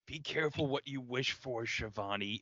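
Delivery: quiet mystic warning like a wizard; a hint of Doctor Strange, said softly; Doctor Strange impression. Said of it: "trying to do a Doctor Strange style".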